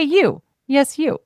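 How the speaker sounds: ordinary talk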